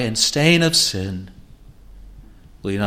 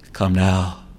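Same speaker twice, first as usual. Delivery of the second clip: normal talking voice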